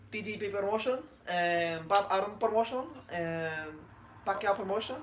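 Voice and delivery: soft, halting boxer thank-you speech; quiet put-on Filipino voice with drawn hesitations; Manny Pacquiao. Host plays boxer thanking promoters, soft halting impression. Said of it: "how does Manny Pacquiao sound?".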